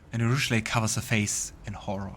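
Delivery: ordinary delivery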